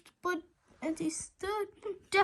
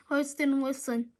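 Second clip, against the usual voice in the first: ordinary delivery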